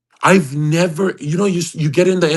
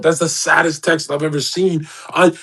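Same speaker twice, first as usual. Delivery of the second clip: normal talking voice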